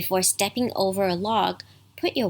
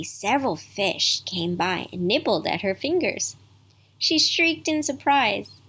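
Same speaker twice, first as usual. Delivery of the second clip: ordinary delivery, nothing marked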